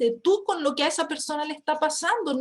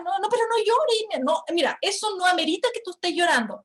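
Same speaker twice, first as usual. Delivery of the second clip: higher-pitched mock protest; dismissive protest; 7 semitones higher than usual. Husband to crying wife, mildly hammed-up protest. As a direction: imitate a husband waving off tears.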